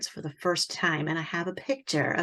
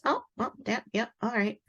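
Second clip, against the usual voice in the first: higher-pitched, noncommittal little reactions; imitated preoccupied technician; 5 semitones higher than usual. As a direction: lightly mimic someone absorbed in a screen. Mimicking tech studying the screen.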